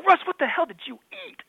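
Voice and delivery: high-pitched mock outrage; retold disgusted outburst. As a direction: demand it in comic disgust.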